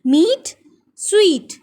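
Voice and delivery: मीट and स्वीट with a long "ee", spaced apart; rhyming pronunciation. Shown as a demonstration. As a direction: slowly rhyme मीट with स्वीट. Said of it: "इन सब की sounds different हैं". (मीट, स्वीट धीरे से लंबी "ई" में)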